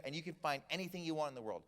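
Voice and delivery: usual speaking voice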